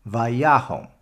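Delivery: "Vayahom" said slowly, ending on a rounded "hom"; Vayahom demo. Shown as a demonstration. Teacher modeling the whole Torah word "Vayahom". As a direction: model "Vayahom" with O in the last syllable. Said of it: "pronounced hom".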